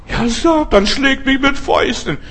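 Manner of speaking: high, strained lament in Paul's voice. Retold or imitated. Apostle Paul complaining about Satan, high lamenting voice. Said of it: "klagt der Apostel Paulus".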